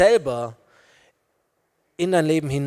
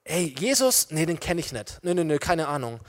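low, soft, dismissive disowning; timid denial; 3 semitones lower, 6 dB quieter than usual. Acted. Peter asked about Jesus, denying him timidly. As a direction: shrink from it and brush the question off quietly. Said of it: "verängstigten Petrus".

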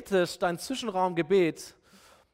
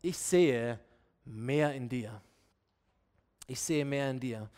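low, measured reassurance with pauses; quiet encouragement; 7 semitones lower, 4 dB quieter, slower than usual. Acted. God's word of encouragement to you, low and tender. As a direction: softly speak belief into someone. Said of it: "Ermutigung ist dieses göttliche Wort".

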